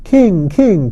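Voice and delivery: put-on a child cries out to the king; child's eager cry. A narrator's reading. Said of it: "cried one".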